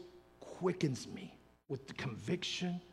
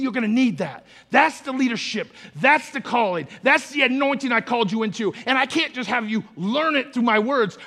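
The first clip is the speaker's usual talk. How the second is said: loud, fast, higher preaching cadence; full-force preaching; 7 semitones higher, 12 dB louder than usual. Acted. Pastor pressing the congregation to hear him, preaching at full force. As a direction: drive it home like a revival preacher.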